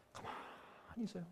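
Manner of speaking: hushed unvoiced whisper; soft whispered counsel. Real, not acted. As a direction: breathe it like a secret. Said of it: "whispered advice".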